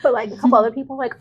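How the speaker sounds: ordinary talk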